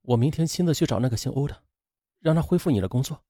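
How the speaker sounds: a quiet night-time murmur; softly. A narrator's reading. Wife softly tells husband she will fix it, hushed. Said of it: "王月轻声说".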